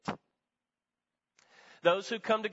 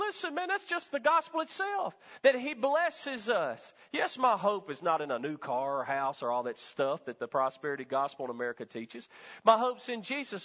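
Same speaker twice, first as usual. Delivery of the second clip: ordinary talk, nothing marked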